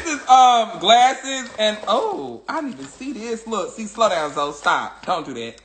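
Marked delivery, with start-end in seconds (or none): none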